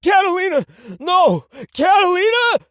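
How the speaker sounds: very high, loud pleading shouts; terrified shout. Acted. Tony screams at Catalina coming to shoot him. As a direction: scream for your life. Tony begging as she comes.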